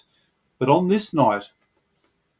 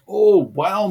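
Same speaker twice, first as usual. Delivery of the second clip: excited wow; 7 semitones higher, 6 dB louder than usual. Retold or imitated. First moved by a verse.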